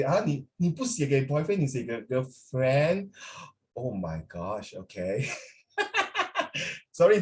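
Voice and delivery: usual speaking voice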